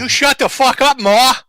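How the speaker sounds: loud outburst at the mother; angry yell. Real, not acted. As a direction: shout her down. Yelling at mother on call.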